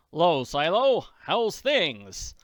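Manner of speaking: a big-hearted millionaire taking charge; hearty Daddy Warbucks. Acted. Warbucks tells Annie he'll soon fix her hunger, loud and hearty.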